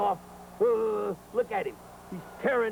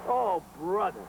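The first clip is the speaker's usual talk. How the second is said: ordinary delivery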